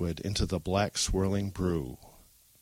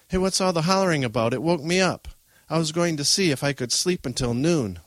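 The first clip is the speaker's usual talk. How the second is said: higher, louder mild grumble; complaining boy; 7 semitones higher, 4 dB louder than usual. Acted. Younger brother woken by hollering, mildly indignant and higher. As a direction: lightly voice a kid complaining.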